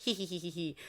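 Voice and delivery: imitated "hee hee" cackle; creepy witch giggle. Maria declaring herself a witch, creepy giggle. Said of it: "she laughs in a really creepy way".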